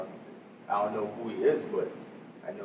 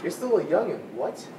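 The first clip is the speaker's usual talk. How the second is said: raised scolding; 7 semitones higher, 8 dB louder than usual. Acted. Older brother scolds younger sibling.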